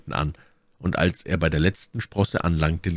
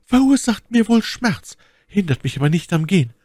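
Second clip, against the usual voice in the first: high-pitched voice for the lady; Milady's voice; 13 semitones higher, 5 dB louder than usual. A narrator's reading. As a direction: play the lady far up in pitch. Narrator voices Milady in dialogue. (hohe Stimme der Mylady)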